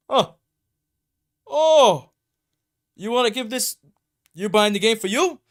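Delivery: high, loud mock-astonished question; nosy store clerk. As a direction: question the customer with exaggerated surprise. Acting the store worker, startled.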